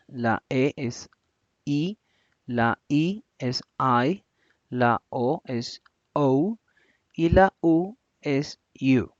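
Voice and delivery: each vowel name said slowly and clearly, English style. Shown as a demonstration. Teacher slowly naming vowels to students. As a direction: spell out the vowels for the class. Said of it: "voy a proceder a pronunciarlas".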